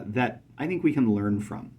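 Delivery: ordinary delivery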